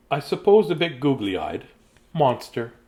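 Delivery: plain reading voice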